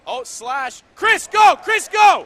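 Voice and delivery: real full-throated yell, pitch soaring; excited shout. Real, not acted. Commentator urging Chris to go, excited shout. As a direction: scream the player on as he breaks away. Cheering on a breakaway in play-by-play.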